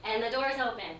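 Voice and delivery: ordinary talk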